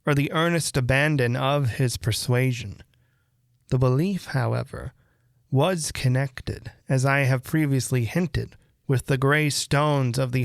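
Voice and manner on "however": ordinary delivery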